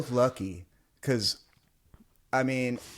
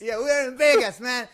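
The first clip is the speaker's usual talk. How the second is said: high, louder imitation of the little guy; mock aggrieved outburst; 11 semitones higher, 4 dB louder than usual. Stranger tells the apologizer off, mock aggrieved. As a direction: plead indignantly, high and loud. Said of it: "I know he's pissed".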